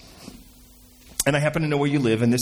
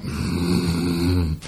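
sulky grunt; 10 semitones lower than usual. Acted. Someone sulks with head dropped, fuming grumble. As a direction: grumble through closed lips. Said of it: "Your head drops and you're like".

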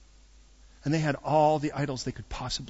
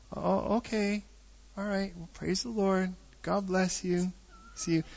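awkward goodbye; 3 semitones higher, 6 dB quieter, slower than usual. Retold or imitated. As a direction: imitate a humbled, polite retreat. Retold awkward phone goodbye after rejection.

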